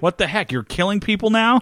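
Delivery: high-pitched mock indignation; retold scolding. Lucky Charlie questions his henchman's killings, incredulous.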